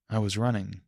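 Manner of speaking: plain reading voice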